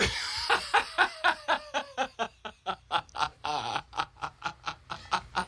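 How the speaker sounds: high laugh tailing into long wheezy chuckles; helpless laughter. Real, not acted. Storyteller after voicing the yelling boy, helpless laughter. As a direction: burst out laughing at your own bit. Cracking up after acted yell.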